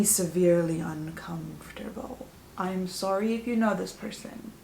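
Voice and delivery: hushed confiding whisper. Real, not acted. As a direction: lean in and whisper it like a secret. Confessing a creepy guy.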